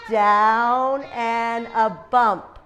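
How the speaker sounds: slow, stretched words; drawn-out demonstration. Shown as a demonstration. Teacher showing how to make a B, slow and drawn out. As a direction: stretch each word along with the pen stroke.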